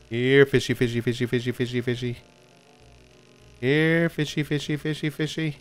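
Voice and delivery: high teasing coax to the fish; coaxing fish call. Acted. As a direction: lure it in like calling a pet. Coaxing fish onto the hook.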